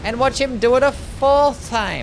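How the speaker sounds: high, loud, slow disbelieving shout at the boss; drawn-out outraged shout; exasperated yell. Real, not acted. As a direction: yell at the screen in real disbelief. Streamer exasperated at boss attack.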